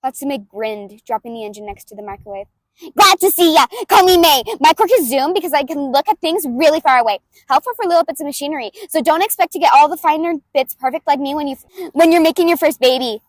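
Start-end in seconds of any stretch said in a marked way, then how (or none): 3.0-13.2 higher, much louder, pushed from the back of the throat; brash inventor girl, throaty and loud; loud brutal May voice. Acted. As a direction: bulldoze him with gleeful energy. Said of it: "I do it in the back of the throat, and I do it loud".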